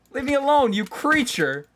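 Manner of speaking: high, loud startled shout; startled yell. Real, not acted. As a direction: yell at it to back off. Yelling at a mob.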